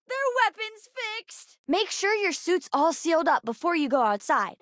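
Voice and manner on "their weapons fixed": high, urgent call; high-pitched battle report; urgent alert. Acted. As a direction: call out the news with a gasp first. Calling out a combat alert.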